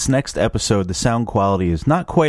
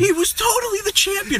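excited high voice. Pretend. Echoing the champion line, higher.

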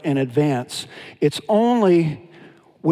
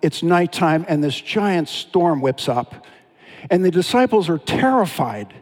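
normal talking voice

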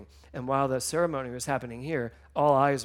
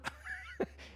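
tearful laugh; 9 dB quieter than usual. Real, not acted. Laugh amid emotional moment.

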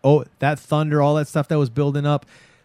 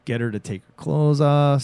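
usual speaking voice

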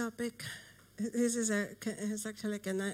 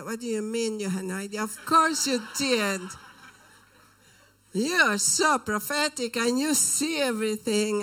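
louder, fast, incredulous quoted retort; imitated loud retort; 9 dB louder than usual. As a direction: throw her words back hard and fast. Girl's angry retort, retold.

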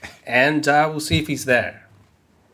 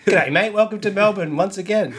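put-on jolly Australian accent, a touch higher; 4 semitones higher than usual. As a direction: greet them in a cheerful Aussie accent. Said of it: "I can hear a similar accent".

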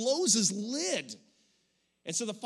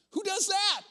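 mock astonished kid; 12 semitones higher, 6 dB louder than usual. Grandkids marveling at grandpa.

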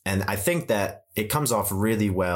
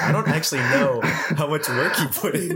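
pitched-up quoted reply; high mock voice; 8 semitones higher than usual. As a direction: jokingly voice your own answer. Quoting own reply, high.

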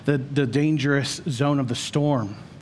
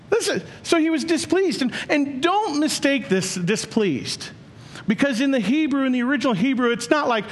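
preaching at full force; 10 semitones higher than usual. Acted. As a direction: thunder the question at the congregation. Preacher keeps the raised delivery.

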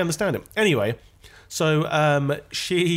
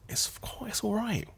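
a real hushed whisper, unvoiced; whispered aside; 8 semitones higher, 9 dB quieter than usual. Real, not acted. Co-host whispers a quick sidenote to his friend, secretive hush. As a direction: breathe it out so only the listener hears.

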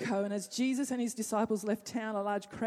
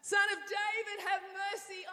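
high pleading shout that fades; a beggar ignoring the crowd, pleading high and loud; crying out again; 11 semitones higher, 8 dB louder than usual. Acted. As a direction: call out high and desperate, then let it sink. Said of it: "He said".

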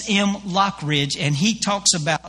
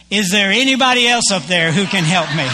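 louder mock plea to anyone else; calling up for help; 5 dB louder than usual. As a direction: call upward, raised voice, as the climber. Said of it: "he looks up and he says".

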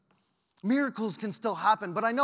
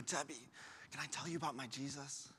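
hushed and low, much softer; quiet, low-voiced tender invitation; gentle, tender offer; 4 semitones lower, 14 dB quieter than usual. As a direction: ask softly, as to someone hurting. Gently offering faith to troubled cashier.

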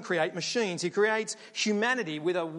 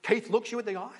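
usual speaking voice

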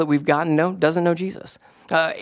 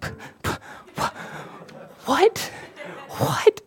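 acted speechless stammer, barely getting it out; mock freak-out; 13 semitones higher, 5 dB quieter, much slower than usual. Pastor reacting to friends' sudden news of belief, mock stunned disbelief. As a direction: imitate being stunned, sputtering into a high "what?". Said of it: "freaking out".

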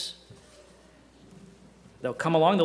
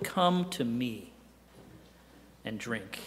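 tender invitation; 5 semitones lower, 8 dB quieter, slower than usual. Retold or imitated. Quoting Jesus' invitation.

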